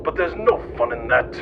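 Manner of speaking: ordinary delivery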